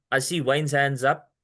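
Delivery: usual speaking voice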